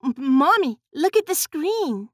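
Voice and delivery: high, stammered cry to mother. A narrator's reading. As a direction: cry out as if startled by a discovery. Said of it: "Judith cried out".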